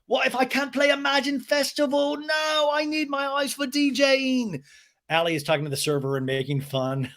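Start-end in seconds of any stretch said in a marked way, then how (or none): 0.1-4.6 high-pitched melodramatic wail; hammed-up whiny lament, pitched high; mock moaning. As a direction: moan it out in exaggerated despair. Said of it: "James moaning, like".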